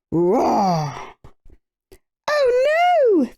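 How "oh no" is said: high, loud panicked cry; frightened cry. A narrator's reading. Bees hearing the bear's crash, a frightened cry. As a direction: cry out in terror. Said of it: "they cried".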